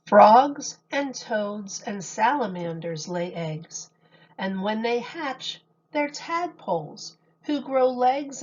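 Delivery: gentle sing-song picture book reading; storybook voice for kids; reading to children. Acted. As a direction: read the rhymes to a little audience. Reads picture book aloud to children.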